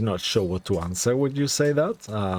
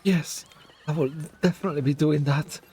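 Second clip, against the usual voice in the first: distraught woman, lightly voiced higher; higher-pitched woman voice; 5 semitones higher than usual. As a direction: speak as the grieving cousin. GM voices cousin NPC.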